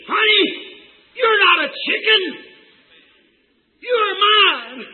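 high, loud cry of a mother eagle; screamed call. Acted. Mother eagle to her eaglet in barnyard, screamed call. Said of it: "and she screamed".

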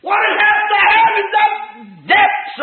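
high, loud pulpit cry; preaching shout. Real, not acted. Preacher urging congregation toward God's love, impassioned shout. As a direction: burst out with fervent conviction. Preacher bursts out on main point.

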